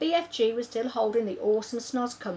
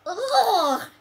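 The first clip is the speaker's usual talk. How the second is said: loud, higher disgusted splutter; spluttered ugh; 12 semitones higher, 9 dB louder than usual. Acted. Sophie tasting Snoscumber, loud spluttered ugh. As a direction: spit it out in disgust. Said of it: "she spluttered".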